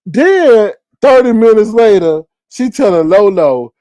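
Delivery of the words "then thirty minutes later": ordinary delivery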